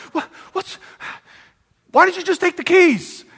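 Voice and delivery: gasping stammer into a high-pitched question; sputter, then ask in baffled disbelief; hammed-up incredulous questioning; acted astonishment. Acts out astonished grace to thief.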